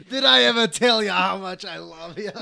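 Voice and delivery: put-on sloppy laughing drunk. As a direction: gush like a happy drunk. Voicing sappy drunk.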